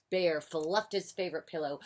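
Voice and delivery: ordinary delivery, nothing marked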